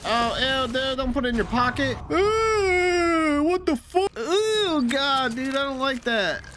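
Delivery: real disgust at a gross texture; grossed-out squeal. Real, not acted. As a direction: recoil in disgust, voice jumping up. Disgusted by gross game visual.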